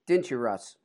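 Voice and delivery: normal talking voice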